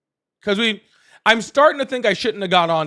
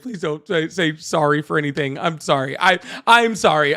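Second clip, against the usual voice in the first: normal talking voice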